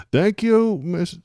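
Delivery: high, prim Mid-Atlantic woman; Pam Bondi voice. Shown as a demonstration. Streamer mocking the Attorney General, prissy high lady voice. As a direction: put on a prissy lady's thank-you. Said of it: "Pam Bondi voice".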